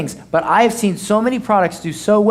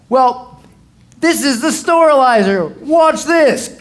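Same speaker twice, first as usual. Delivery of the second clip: cheesy pitchman; 7 semitones higher, 4 dB louder, slower than usual. Retold or imitated. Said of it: "and then you go".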